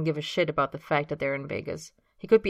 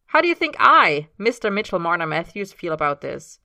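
playful teasing; 8 dB louder than usual. Acted. Mitch teasing over the M's.

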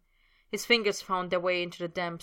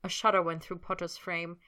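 usual speaking voice

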